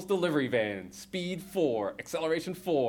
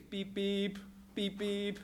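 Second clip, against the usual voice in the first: high soft beeping horn; horn beeps; 5 semitones higher, 7 dB quieter, much slower than usual. Acted. Narrator voicing the van's horn, beeping. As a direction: tap out a car horn with your voice.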